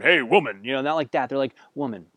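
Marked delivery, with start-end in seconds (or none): 0.0-0.6 loud, curt "Hey, woman"; rough, loud hail; brusque call. Shown as a demonstration. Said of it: "it's not like they're going". 1.7-2.1 respectful, affectionate address; term of endearment. Shown as a demonstration. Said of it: "term of endearment".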